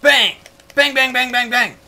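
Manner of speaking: high, loud "bang" in rapid bursts; playful shouted gunshots; mock gunfire. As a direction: shout bangs like a child playing guns. Voicing gunfire along with the game.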